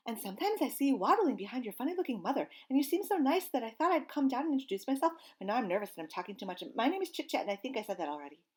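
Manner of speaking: fast, high, nervous squirrel chatter; rambling squirrel. Acted. Nervous squirrel rambles to the gosling, fast and high. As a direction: rush it out, too nervous to stop.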